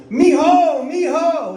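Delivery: high falsetto moan; hammed-up high moaning; imitating the woman. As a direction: squeal it back like she did. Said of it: "I go".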